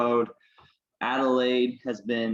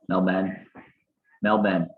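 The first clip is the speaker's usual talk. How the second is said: Australian accent; 3 semitones lower than usual. Shown as a demonstration. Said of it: "Melbourne is how you say it with the accent".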